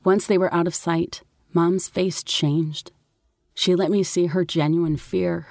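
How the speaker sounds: ordinary delivery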